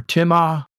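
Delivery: "timao" with MAH, then a separate OH; alternate form pronounced. Shown as a demonstration. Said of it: "is the way you say that".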